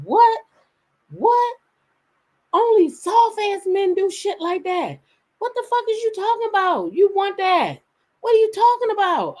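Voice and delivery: high, loud-edged indignant outburst; retold outrage in a high-pitched voice; outraged disbelief. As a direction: imitate an incensed son, voice shooting up high. Acts her son's angry disbelief.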